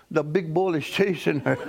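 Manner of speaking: usual speaking voice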